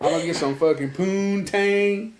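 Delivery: grinning, eager imitation of a friend; excited friend. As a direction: play the buddy hyped about his date. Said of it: "He's like".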